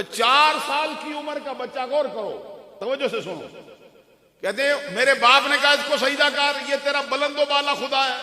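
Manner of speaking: loud, high, urgent sermon voice; preaching at full force. Acted. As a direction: press the congregation to take it in.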